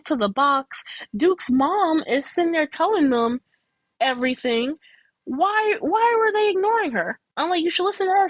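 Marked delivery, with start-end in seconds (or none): 5.2-8.3 high-pitched exasperated questioning. Retold or imitated. Viewer protesting that nobody listens to Duke's mom, exasperated. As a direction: plead in disbelief, pitched way up. Baffled at characters ignoring the mom.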